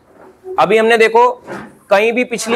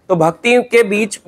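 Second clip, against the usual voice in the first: ordinary talk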